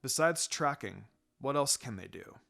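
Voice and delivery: a relaxed, unhurried teacher; Kakashi's voice. A narrator's reading.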